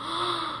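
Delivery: soft, acted gasp; small, scandalized intake of breath; shocked gasp. Retold shock at customer's insult.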